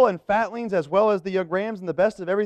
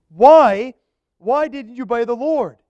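pressing Samuel; 5 semitones higher, 9 dB louder than usual. Acted.

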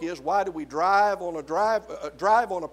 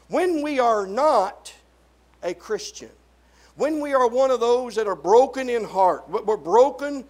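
raised, higher preaching voice with hard stresses; forceful preaching; 5 semitones higher, 7 dB louder than usual. Real, not acted. Preacher challenging those broken in sin, forceful preaching. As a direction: drive each phrase at the congregation.

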